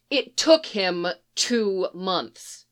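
ordinary delivery

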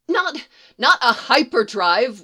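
loud, slow, stammered; can't help correcting them; stammering correction. Acted. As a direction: scientist blurts a correction to the trooper, stammering. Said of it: "one of the scientists says".

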